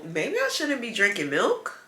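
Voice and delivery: ordinary talk, nothing marked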